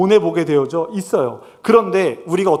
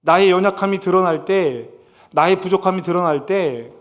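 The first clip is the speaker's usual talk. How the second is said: ordinary talk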